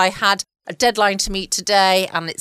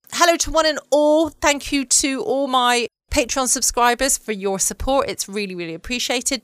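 higher, upbeat host welcome; podcast host greeting; 6 semitones higher than usual. Real, not acted. Host welcoming listeners and thanking Patreon supporters, upbeat announcer lilt.

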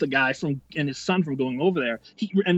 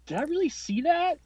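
stunned double take; 13 semitones higher than usual. Retold or imitated. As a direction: act out doubting your own eyes. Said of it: "like a double take".

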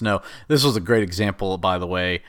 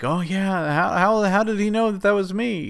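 higher, lightly voiced girl; mock surprised Natalia; 9 semitones higher than usual. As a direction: play it a touch higher, naive.

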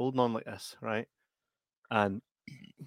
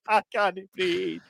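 slow, gasping through giggles; breathless with laughter; 6 semitones higher, slower than usual. Retold or imitated. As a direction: wheeze it out, laughing. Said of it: "But I'm like".

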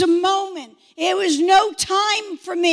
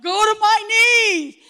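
high, loud, breakthrough shout of discovery; excited realization; 4 semitones higher, 6 dB louder than usual. Retold or imitated. New convert copying worshippers going to their knees, excited shout. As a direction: exclaim it as a delighted discovery. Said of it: "I'm like".

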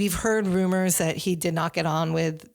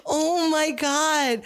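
laughing; 7 semitones higher, much slower than usual. Real, not acted. Reacting to a funny remark.

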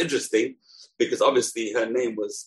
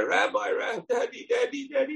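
put-on high, whiny child's wail, much higher; crying little boy. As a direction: whimper like a small boy who lost at hide and seek. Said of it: "came crying to the rabbi".

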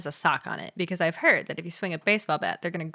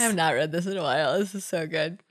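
ordinary talk

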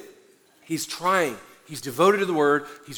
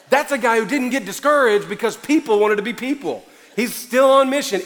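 loud, higher, driving sermon climax; preaching at full force; 5 semitones higher, 6 dB louder than usual. Acted. Pastor urging the church to keep sharing, loud preaching. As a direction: fire up the congregation.

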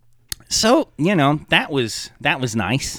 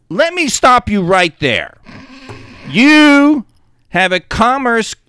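high, loud mock-instructive voice; mock lecturing voice; 12 semitones higher, 7 dB louder than usual. Player mimicking a helper explaining the game, mock lecture. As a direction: play the know-it-all explaining rules. Imitating someone explaining a quest.

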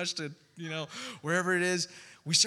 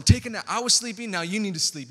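much higher, louder order that eases off; playful command; 5 semitones higher, 7 dB louder, faster than usual. Retold or imitated. Jesus tells the storm to take a nap, loud and playful. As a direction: boss the storm to bed.

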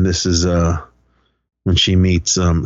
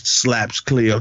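usual speaking voice

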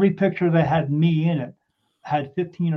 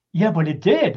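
excited; 5 dB louder than usual. Retold or imitated. Telling the investigator it did say sentences, excited protest. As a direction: push back with eager excitement. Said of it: "'cause I was excited about it".

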